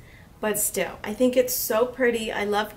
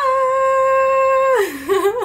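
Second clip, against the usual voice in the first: squealing laugh; 12 semitones higher, 7 dB louder than usual. Real, not acted.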